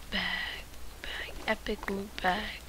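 hushed greedy whisper; excited whisper. Real, not acted. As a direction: whisper over the loot. Greedy over loot.

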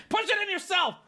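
high-pitched exasperated outburst; mock exasperation. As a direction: give up on the chair in a high, comic cry. Acted exasperation over the chair.

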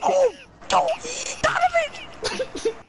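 high cracking panicked shout; panicked yell. Real, not acted. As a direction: scream his name in panic.

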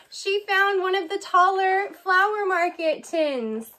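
ordinary delivery

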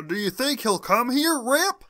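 lightly voiced henchman. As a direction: play the nervous henchman. Henchman asks Rip.

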